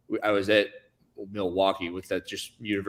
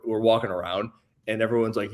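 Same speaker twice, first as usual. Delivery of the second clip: normal talking voice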